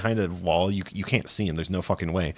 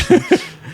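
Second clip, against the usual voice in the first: high, louder burst of real laughter; excited laugh; 5 dB louder than usual. Real, not acted. Recounting a funny scene: real high laugh. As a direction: crack up at your own excitement.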